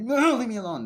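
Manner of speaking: high, loud cry to be let go; mock pleading scream. Victim begs the attackers to stop, high mock yell.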